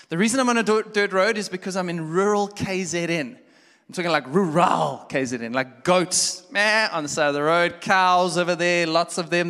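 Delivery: loud, high, animated storytelling; full-force preaching. Acted. Pastor telling a road-trip story, loud and high. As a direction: preach it big to the room.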